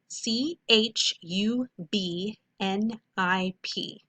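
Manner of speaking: slow letter-by-letter spelling; dictating letters at half speed; spelling slowly. Shown as a demonstration. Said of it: "write this word on your board".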